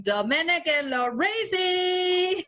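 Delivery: put-on drawn-out Dominican accent shout, laughing. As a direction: call it out slow in a Dominican accent. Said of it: "and I'm all like".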